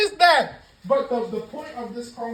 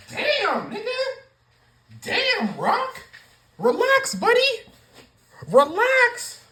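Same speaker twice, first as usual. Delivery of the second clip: high, loud exasperated calls; exasperated yelling; 14 semitones higher, 4 dB louder, slower than usual. Real, not acted. Vlogger yells at The Rock on TV to relax. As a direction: yell at the screen to calm him down.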